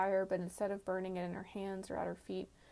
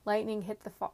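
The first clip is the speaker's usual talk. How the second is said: ordinary delivery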